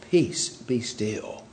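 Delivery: soft, slow, low and calm. Acted. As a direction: say it gently, unhurried, as if just woken. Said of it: "very calmly saying".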